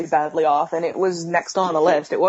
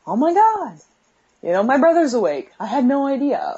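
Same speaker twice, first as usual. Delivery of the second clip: surprised; 6 semitones higher than usual. Retold or imitated. Retold surprised realisation.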